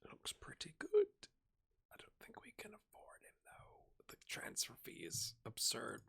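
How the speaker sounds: soft voiceless whisper. Real, not acted. Manager weighing an unaffordable signing, whispered. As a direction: murmur it as if thinking aloud. Whispered asides checking a transfer target.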